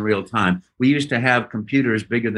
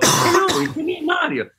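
high, bright-pitched Mario. Retold or imitated. Friend's first try at Mario, high and chirpy. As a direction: chirp it out as the cheerful plumber. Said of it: "He goes".

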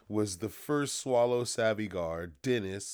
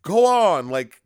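high, loud impatient urging; mock King Arthur; 10 semitones higher, 11 dB louder than usual. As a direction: push them to get on with it. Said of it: "he's like".

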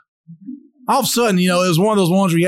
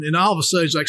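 ordinary talk

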